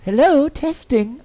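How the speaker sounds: loud, slow drawn-out hello into the mic; testing the new mic with a big hello; mic check call. Real, not acted. Streamer checking his microphone, loud and slow.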